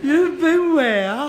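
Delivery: high, loud, drawn-out disbelief; incredulous. Acted. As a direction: stretch it out, utterly taken aback. Startled by father's confession.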